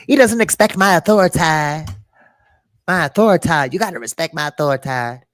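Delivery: shrill bratty "respect my authority" bit; bratty cartoon kid. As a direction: stomp it out like a spoiled cartoon boy. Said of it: "I don't know why I'm saying it like that".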